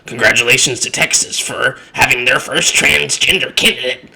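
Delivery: lightly voiced smug statement; a hint of a snide official. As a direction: read it with a faint smirk. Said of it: "saying that".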